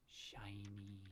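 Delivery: low, whispered coveting; hushed, lovestruck whisper; hushed awe. Pretend. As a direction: murmur it softly to your treasure. Murmuring over favourite revolver.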